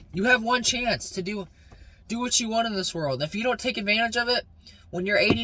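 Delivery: fired-up motivational rant; getting aggressive. Real, not acted. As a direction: hammer it home like a pep talk. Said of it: "I'm sorry I'm getting aggressive".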